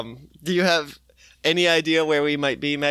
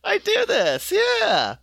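mock realization. Retelling sudden realization while editing.

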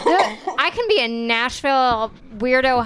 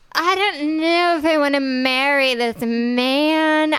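put-on slow, drawling Southern belle accent; slower than usual. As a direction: play a flirty Southern belle, slowly. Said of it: "where are you from with that accent".

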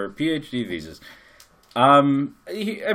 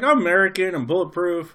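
cocky American; 7 semitones higher, 5 dB louder than usual. Retold or imitated. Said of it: "I was like".

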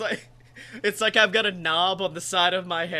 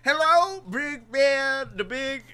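Cosby voice; 6 semitones higher, 5 dB louder than usual. Pretend. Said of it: "One side says Cosby".

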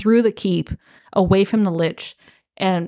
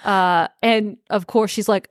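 ordinary talk, nothing marked

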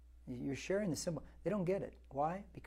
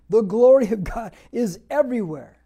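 higher, louder proclamation; preaching shout; 6 semitones higher, 15 dB louder than usual. Real, not acted. Pastor proclaiming to congregation, full shout. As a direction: belt it out to the back pew. Preaching climax on God's glory.

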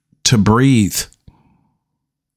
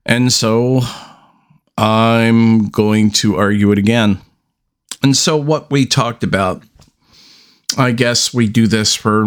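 ordinary talk, nothing marked

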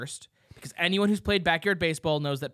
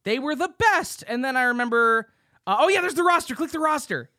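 excited; 6 semitones higher, 4 dB louder than usual. Real, not acted. Excited recognizing game characters.

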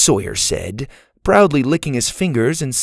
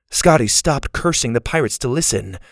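ordinary delivery, nothing marked

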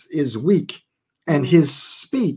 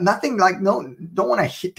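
ordinary talk

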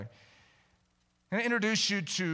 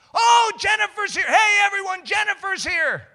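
high, loud, delighted calling out; hammed-up squeal of welcome to everyone; gushing excitement; 11 dB louder than usual. Teachers falling all over a former student, gushing.

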